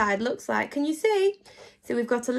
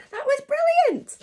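excited praise; 14 semitones higher, 3 dB louder than usual. Real, not acted. Celebrating children's success.

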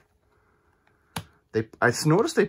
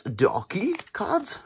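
French accent; 10 semitones higher, much slower than usual. Shown as a demonstration. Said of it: "my French accent's not great".

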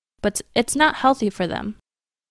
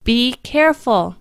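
slow, slightly raised "Be careful." with the stress on "careful"; loud warning; 4 semitones higher, 3 dB louder than usual. Shown as a demonstration. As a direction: call out "Be careful." like a habitual parental warning. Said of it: "most of the time with a loud voice".

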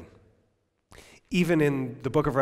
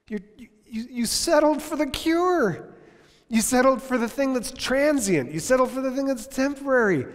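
impassioned cry; 12 semitones higher, 5 dB louder than usual. Real, not acted. Preacher pleading with the congregation, anguished and loud. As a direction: cry it out at full force. Climactic rebuke, far higher, louder.

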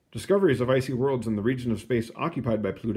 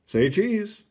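bright, high "say cheese"; cheery Pluto; 9 semitones higher, 4 dB louder than usual. Acted. Pluto calling to the telescopes, chirpy and high. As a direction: pose and grin for the camera.

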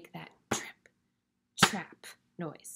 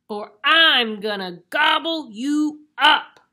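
very loud, scary troll; 7 semitones higher, 15 dB louder, slower than usual. Acted. Troll refuses the little goat, bellowing. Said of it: "said the troll".

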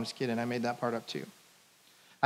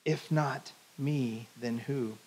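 gentle whisper; 2 semitones lower, 6 dB quieter, much slower than usual. Pretend. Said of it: "And Jesus whispers".

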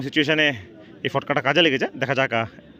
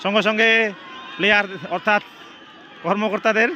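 excited winning call; 5 semitones higher than usual. Real, not acted. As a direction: shout the winner with rising joy. Winning penalty, team reaches final.

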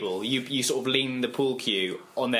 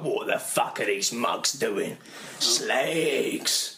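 bouncer voice; 3 semitones lower, much slower than usual. Retold or imitated. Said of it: "that's how bouncers speak".

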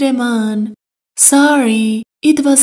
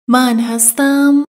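ordinary talk, nothing marked

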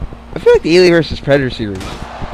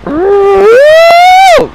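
mock Predator screech; 9 dB louder than usual. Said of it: "And Pred's like".

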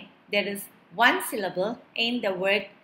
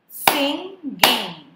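"Singing" in two loud, higher beats; syllable by syllable; 4 semitones higher, 5 dB louder than usual. Shown as a demonstration. Teacher leading clapping practice, "Singing" split in two. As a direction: punch each half of "Singing", sing, ging. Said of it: "identify syllables by clapping".

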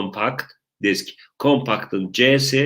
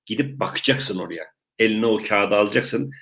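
ordinary delivery

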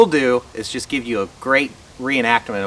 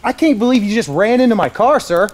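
indignant; 10 semitones higher, 4 dB louder than usual. Acted. Acted confronting officer.

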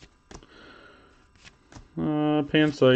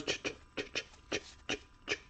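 mock stutter; 2 semitones higher, faster than usual. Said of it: "He said".

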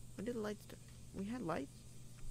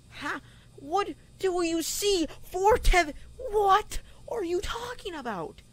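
incredulous; 9 semitones higher, slower than usual. Real, not acted. Confused by game puzzle.

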